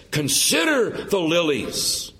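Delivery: drawn out, each word weighed; slow, pointed command to think; slow and deliberate. Acted. The preacher quotes Jesus, slow and deliberate.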